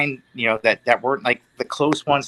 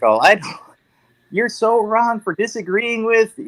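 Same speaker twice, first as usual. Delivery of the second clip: higher, slow, hammed-up indignant fan voice; mocking a loyal fan; 9 semitones higher than usual. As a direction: mimic a smug subscriber scolding someone, pitched up and drawn out. Mocking blindly loyal subscribers.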